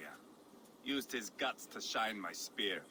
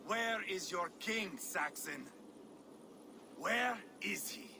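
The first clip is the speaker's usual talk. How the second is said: menacing taunt; 7 semitones higher than usual. Acted. Ivar menaces a cowering Saxon.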